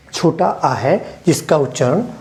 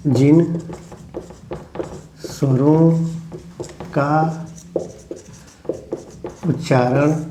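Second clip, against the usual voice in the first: one word at a time, long pauses; dictation; 4 dB quieter, much slower than usual. Shown as a demonstration. Teacher dictating to the class, each word set apart.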